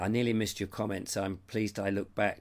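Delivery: normal talking voice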